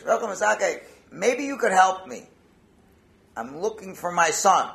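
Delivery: acted loud, urgent request; insistent father. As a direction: call out to the rabbi for help. Father urgently accosts rabbi about son.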